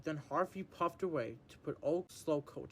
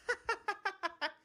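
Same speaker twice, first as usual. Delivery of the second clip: high mocking cackle. James laughing at her.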